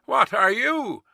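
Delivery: high challenging call; sharp retort. A narrator's reading. Guard to voice in the mist, challenging retort. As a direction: demand it of the stranger. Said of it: "the guard retorted".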